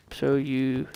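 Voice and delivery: ordinary talk, nothing marked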